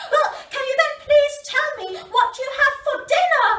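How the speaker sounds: put-on a young elephant pressing her question; eager baby elephant. A narrator's reading. Baby elephant pressing the crocodile, high eager voice.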